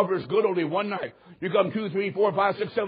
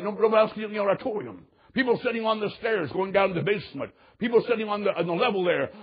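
ordinary talk

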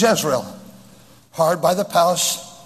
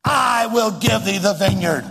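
raised-pitch, commanding Jezebel; bossy wife; 4 semitones higher than usual. Acted. Jezebel ordering her pouting husband to get up, bossy command. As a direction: order him about like the boss. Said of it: "What woman said".

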